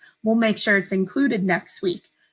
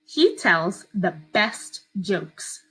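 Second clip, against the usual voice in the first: very slow, even dictation; dictating; much slower than usual. Shown as a demonstration. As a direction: read it slowly for children to write. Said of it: "I'm gonna read it to you twice".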